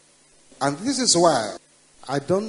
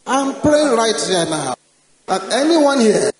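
preaching at full force; 4 semitones higher, 10 dB louder than usual. Acted. Preacher commanding the crowd to shout amen, roaring. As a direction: command it with all your force.

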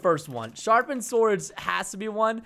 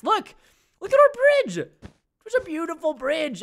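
excited; 7 semitones higher, 3 dB louder than usual. Real, not acted. Excited about the bridge.

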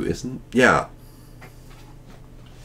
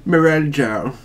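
loud, higher mock-British name; British accent; 8 semitones higher, 6 dB louder than usual. Shown as a demonstration. Player trying the name on a friend's suggestion, mock British accent. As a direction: put on a hammed-up British accent. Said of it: "start doing a British accent".

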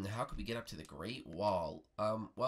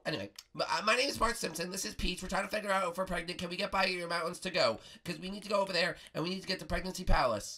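put-on high, hurried boy; Bart Simpson; 9 semitones higher, 6 dB louder, faster than usual. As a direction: rattle off the request in a boyish pitch.

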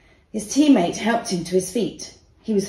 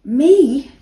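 surprised; 6 semitones higher, 9 dB louder than usual. A narrator's reading. Surprised to be chosen.

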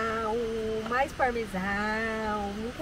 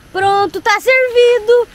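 loud and high, slowing on servido; loud announcing; 8 semitones higher, 14 dB louder, slower than usual. Real, not acted. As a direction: proudly announce the meal. Serving food to the camera.

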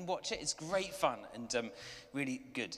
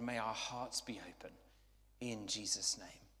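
praying; 6 semitones lower than usual. Acted. Leads a short prayer.

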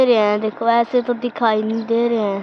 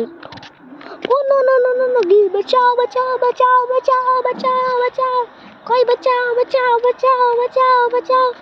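high, drawn-out hammed-up cries for help; mock panic; 12 semitones higher, slower than usual. Joking to viewers she is falling, high mock panic. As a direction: squeal the calls for help like a cartoon in trouble.

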